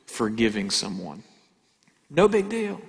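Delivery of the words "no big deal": high, airy no big deal; breezy brush-off. Retold or imitated. Preacher mimicking someone shrugging off hurt, breezy. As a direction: toss it off like it's nothing.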